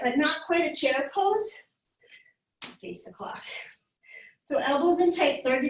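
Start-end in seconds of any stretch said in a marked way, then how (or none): 2.8-3.4 soft unvoiced whisper. Real, not acted. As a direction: whisper the cue. Cue during class.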